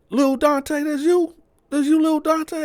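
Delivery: very high, loud voicing of the greeter; excited recognition. Bo spots Lil Dante in the unit, excited.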